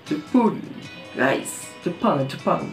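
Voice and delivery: normal talking voice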